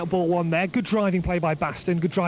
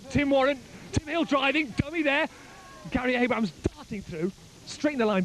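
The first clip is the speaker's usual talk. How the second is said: higher, urgent burst of play-by-play; excited call; 6 semitones higher, 3 dB quieter than usual. Real, not acted. Commentator calling the attack to listeners, excited burst. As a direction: ride the moment of the dummy.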